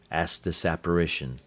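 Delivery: plain reading voice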